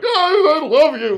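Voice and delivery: very high, loud tearful cry; crying love. Retold or imitated. Telling the coach on the phone, crying loud and high. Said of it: "hysterically crying".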